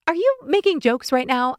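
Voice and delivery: incredulous. Acted. Narrator indignant, louder.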